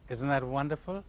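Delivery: ordinary talk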